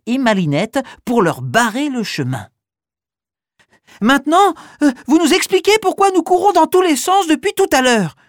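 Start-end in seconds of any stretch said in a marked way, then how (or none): 4.0-8.2 high, louder insistent demand; demanding child. Acted. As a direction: stop them and demand answers. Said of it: "exige Malika, rouge comme un coquelicot".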